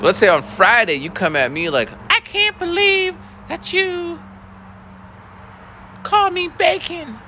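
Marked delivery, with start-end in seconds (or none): 2.1-7.2 put-on very high, slow whiny child voice; mock upset student; whiny kid. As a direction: drag out the hurt complaint. Kid complaining, mocked.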